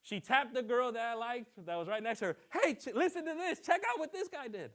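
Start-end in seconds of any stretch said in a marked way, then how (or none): 2.5-4.7 high, eager tip-off to a friend; excited cashier. Retold or imitated. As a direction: pitch it up like spilling gossip. Lady tells girl excitedly.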